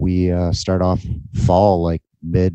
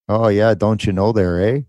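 hammed-up Canadian accent, a little higher; terrible Canadian accent; 3 semitones higher, 3 dB louder than usual. Co-host teasing his podcast partner, bad Canadian accent. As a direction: put on a cartoonish Canadian accent. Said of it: "Here we go with that terrible accent again".

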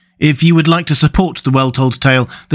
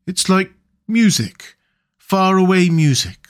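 sleepy, slow Mole voice; dreamy and half asleep; drowsy; 5 semitones higher than usual. Acted. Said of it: "nodding drowsily".

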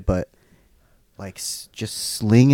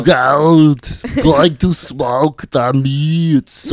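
slow, loud, higher mock Arnold; Arnold impression; 6 semitones higher, 10 dB louder, much slower than usual. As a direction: drag out the words like Arnold. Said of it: "Let's try to do Arnold".

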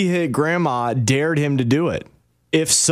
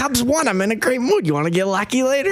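flirty grandma; 7 semitones higher than usual. Retold or imitated. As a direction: tease him with a flirty offer, high and fast. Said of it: "voicing grandma flirting".

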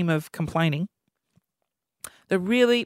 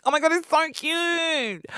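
put-on cooing at a baby; 8 semitones higher, 3 dB quieter than usual.

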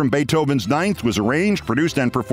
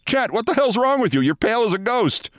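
higher, startled concern; alarmed; 8 semitones higher than usual. Retold or imitated. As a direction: react alarmed at a pale friend. Owner alarmed at pale friend.

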